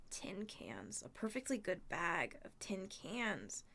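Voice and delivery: slow, sad whispered lament; mournful whisper. Acted. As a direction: mourn the lost cans quietly. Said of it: "Grover brayed mournfully".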